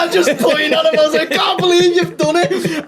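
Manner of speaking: very high, laughing re-enacted yell; screaming in retelling. Comic pointing at his mate on stage, screaming with laughter. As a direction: squeal it in gleeful disbelief. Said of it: "I start screaming".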